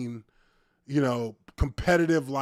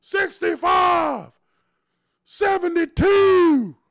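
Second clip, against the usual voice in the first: high, loud, slow shouted numbers and insults; heckler yell; 11 semitones higher, 8 dB louder, slower than usual. Retold or imitated. Imitating the fan berating the linemen in the back, loud mock shout. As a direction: yell down from the stands at the players. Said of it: "He's like".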